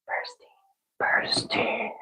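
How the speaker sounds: very soft whisper to oneself; whispered repetition. Real, not acted. Streamer trying out the disputed name, whispering. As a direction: whisper it, testing the sound.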